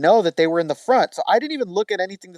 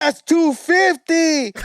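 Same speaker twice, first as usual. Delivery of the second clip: slow, higher Chicano street drawl; 11 semitones higher, much slower than usual. Retold or imitated. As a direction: savor the price in a put-on Chicano accent. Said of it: "Yeah, Mexican".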